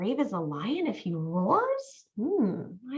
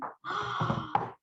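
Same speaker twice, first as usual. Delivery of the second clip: gasp; 6 semitones higher, 7 dB quieter than usual. Acted. Awaiting lion's roar.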